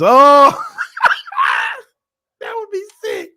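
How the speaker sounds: high, loud squeal of delight; excited shriek. Real, not acted. Streamer shrieking at a fan's joke, delighted.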